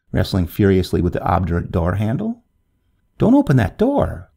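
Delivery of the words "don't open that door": high-pitched urgent warning; narrator's mildly alarmed cry; anxious cry. A narrator's reading. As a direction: call out a worried warning. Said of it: "cried the young man anxiously".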